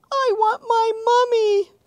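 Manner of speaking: high, loud terrified wail; panicked wail. Acted. A terrified monster wailing for his mummy. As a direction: wail for mummy in fake terror.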